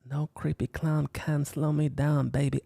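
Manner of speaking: usual speaking voice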